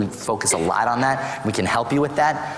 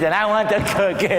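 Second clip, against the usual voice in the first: mild imitation of a child wanting a cookie; a light, high whine of a kid; whiny child; 8 semitones higher than usual. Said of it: "he tantrums and kids".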